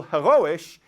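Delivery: heroisch said "heh-RO-ish", stress on RO; German word heroisch. Retold or imitated. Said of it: "he said it in German".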